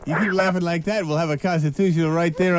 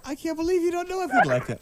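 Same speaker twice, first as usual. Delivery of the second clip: much higher, softer mock amazement; mock disbelief; 10 semitones higher, 6 dB quieter than usual. Host to caller Barbara, mock shocked disbelief. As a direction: gasp it out in fake shock.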